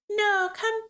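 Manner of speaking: put-on squeaky high baby voice. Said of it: "what's the baby voice?".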